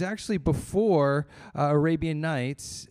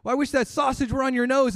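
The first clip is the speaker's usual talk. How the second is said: higher and fast, fed-up retort; irritated woodcutter; 7 semitones higher, 3 dB louder, faster than usual. Acted. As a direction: fire back in a huff. Woodcutter snaps back in anger.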